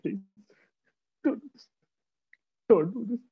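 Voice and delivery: high, tearful begging for her cubs; crying plea. Acted. Mother begs the hunter to spare her cubs, tearful and high. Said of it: "she started crying".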